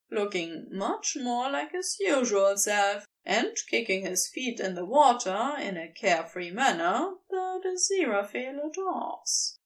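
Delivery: ordinary delivery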